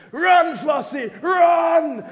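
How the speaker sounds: acted high, full-volume warning cry; urgent shout. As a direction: yell a desperate warning across a field. Shepherd calling to sheep as wolf comes.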